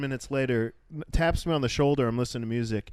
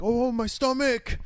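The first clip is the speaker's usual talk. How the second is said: whiny fake stomachache; 9 semitones higher, slower than usual. Suggested excuse, acted quote.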